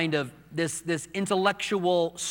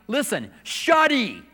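high, loud, drawn-out put-down; emphatic rebuke; 7 semitones higher, 5 dB louder, slower than usual. Acted. Preacher silencing those who say don't cry, emphatic rebuke. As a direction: cut them off firmly, slow and raised.

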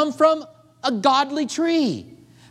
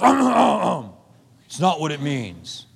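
mock Calvinist; 6 semitones lower than usual. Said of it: "And the Calvinist goes".

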